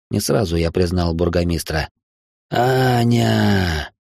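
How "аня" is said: long, faint drawn-out call; weak voice. A narrator's reading. Wounded baron calls to his daughter in a weak voice. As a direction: call out feebly to a loved one. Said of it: "слабым голосом произнёс он".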